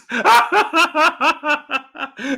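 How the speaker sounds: big high-pitched belly laugh; real laughing fit. Real, not acted. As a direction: burst out in helpless laughter. Cracked up by chat message.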